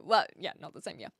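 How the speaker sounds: ordinary talk, nothing marked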